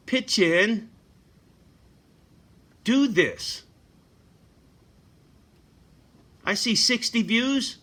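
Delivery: a real, heated call-out to silent viewers; angry rant. Real, not acted. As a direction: chew out the lurkers who never help.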